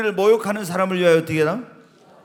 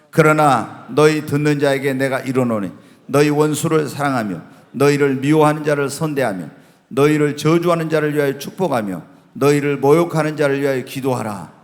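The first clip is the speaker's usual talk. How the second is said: loud, low, measured Bible reading; scripture reading; 5 semitones lower, 4 dB louder than usual. Acted. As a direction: read the verse out firmly to a congregation. Reads Bible verses aloud to congregation.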